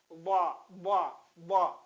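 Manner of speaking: ba ba ba said as the Arabic Dad syllable; Arabic syllable demo. Shown as a demonstration. Teacher modelling ba, asking students to listen. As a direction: model ba three times for the class. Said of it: "presta atenção na pronúncia do professor".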